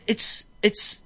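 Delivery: imitating astonished recognition; retold awed reveal. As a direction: stammer the name as if mildly stunned.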